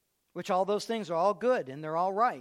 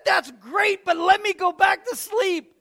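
high, loud, slow dismissive wife; hammed-up sleepy wife; 10 semitones higher, 11 dB louder, slower than usual. Mother brushing off husband, mock sleepy.

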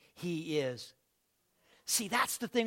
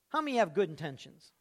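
ordinary delivery

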